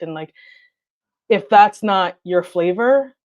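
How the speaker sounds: usual speaking voice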